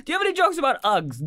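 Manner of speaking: high, quick audience-request voice; mock demanding heckler. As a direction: pester the comic in a put-on high voice. Mimics the demanding audience woman.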